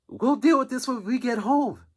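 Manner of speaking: a high put-on mom threatening her son; imitating the mother.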